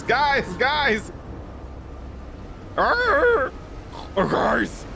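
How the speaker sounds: a breathless pug begging them to listen; pug calling out. Pretend. Pug warning the wolves.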